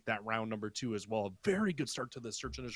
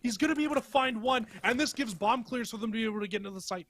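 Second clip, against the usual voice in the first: esports caster hype; 12 semitones higher, 6 dB louder than usual. Real, not acted. Caster reacts to a surprise sniper shot.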